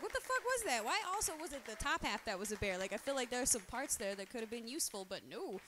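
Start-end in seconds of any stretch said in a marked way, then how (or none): 0.0-2.8 incredulous. Retold or imitated. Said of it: "I woke up, I was like".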